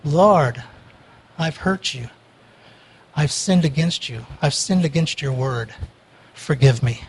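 low, soft, broken repentance; contrite prayer. Pretend. Preacher shows true repentance to God, low and hushed. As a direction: pour out a hushed, grieving confession.

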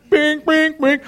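repeated high-pitched "bing" alert sounds; cartoon signal pings; high pinging noise. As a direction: imitate flashing lights with high pings. Imitates lights pointing at him.

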